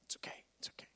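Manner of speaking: soft voiceless whisper; imitating voiceless Bob reassuring. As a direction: whisper it calmly like a man with no voice. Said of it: "He goes".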